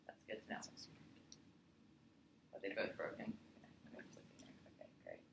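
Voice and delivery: hushed whispered aside; whispering. Real, not acted. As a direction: whisper off to the side. Asides to someone off-camera.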